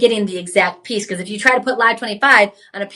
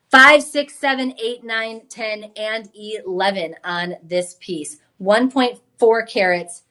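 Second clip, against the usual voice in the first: usual speaking voice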